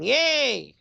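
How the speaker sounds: high, drawn-out little cheer; happy cheer. Real, not acted. Streamer sees the lights are back on, happy cheer.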